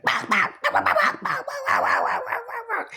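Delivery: high-pitched bird babble, jumping way up; bird chatter imitation. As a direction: mimic a chattering bird with bah sounds. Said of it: "He like".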